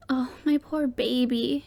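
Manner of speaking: slow pained cry; mother's anguished exclaim. A narrator's reading. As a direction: ache over her son. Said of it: "she exclaimed in anguish".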